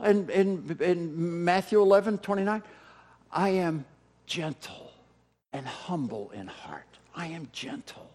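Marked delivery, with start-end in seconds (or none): 3.3-8.1 much softer, almost whispered "I am gentle"; hushed gentle quote. Shown as a demonstration. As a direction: let the words fall to a hush.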